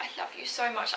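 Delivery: softly murmured affection; tender, emotional. Retold or imitated. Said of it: "I'm getting emotional thinking about it".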